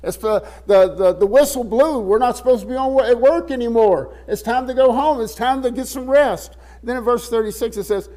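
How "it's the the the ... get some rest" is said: higher, brisk tired-workers complaint; like workers when the quitting whistle blows; tired disciples wanting to quit. Retold or imitated. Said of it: "In other words, they're saying".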